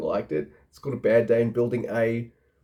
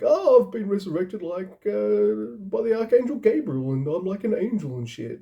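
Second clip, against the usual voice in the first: mock villain; 9 semitones higher than usual. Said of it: "And then he's like".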